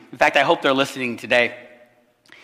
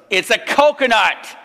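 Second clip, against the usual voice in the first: higher, slower, louder amused announcement; amused reveal; 8 semitones higher, 4 dB louder than usual. Retold or imitated. A chuckling stranger reveals the floating body is a coconut. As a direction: savour the punchline.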